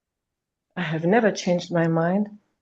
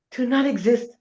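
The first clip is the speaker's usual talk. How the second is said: ordinary talk, nothing marked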